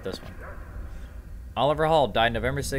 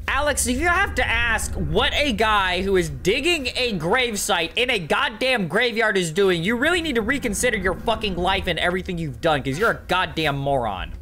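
exasperated rant; 7 semitones higher than usual. Real, not acted. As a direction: spell out the obvious with mock outrage. Scolding a viewer over a question.

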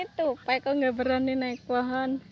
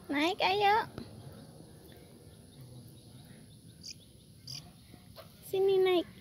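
higher sing-song coaxing with rising yuk; coaxing pet talk; 7 semitones higher, slower than usual. Acted. Urging her squirrels to climb the tree, higher sing-song coax. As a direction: coax them up like a fond owner.

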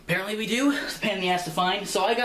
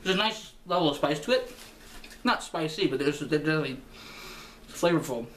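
speaking between bites; talking while chewing. Real, not acted. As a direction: mouth partly full. Seen 0: Talks with puffed, chewing mouth right after eating chicken.

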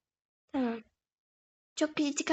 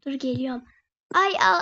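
normal talking voice

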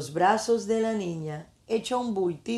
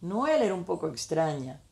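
ordinary delivery, nothing marked

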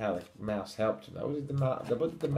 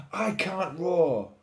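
high, loud, drawn-out lament; mildly sniffly cub; 8 semitones higher, 7 dB louder, slower than usual. A narrator's reading. Little Lion confessing to Mouse, mild slow wail. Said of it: "sniffled Little Lion".

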